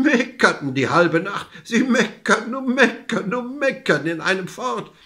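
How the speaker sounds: high, bleating goat-like telling. A narrator's reading. Old couple bleat all night at the goat, bleating voice.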